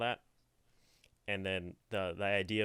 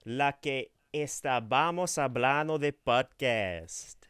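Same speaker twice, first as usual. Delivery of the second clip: slow Spanish read; 4 semitones higher, 5 dB louder, slower than usual. Shown as a demonstration. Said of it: "In your best accent".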